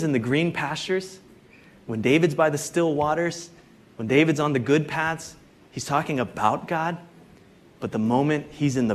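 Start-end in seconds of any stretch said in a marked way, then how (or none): none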